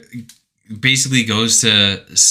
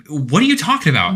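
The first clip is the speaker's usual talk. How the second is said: confused; 6 semitones higher, much faster than usual. Retold or imitated. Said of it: "he's just like".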